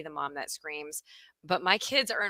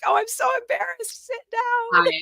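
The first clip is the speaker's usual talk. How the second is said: much higher, mock mortified self-scolding; embarrassed mom; 5 dB louder than usual. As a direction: squeal at yourself to sit down. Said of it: "I was like".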